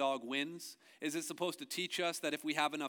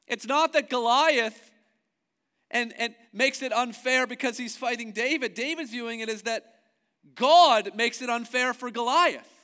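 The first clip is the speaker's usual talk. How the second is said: loud, building preacher's cry; preaching at full force; 8 semitones higher, 12 dB louder than usual. Acted. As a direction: proclaim it to the whole room.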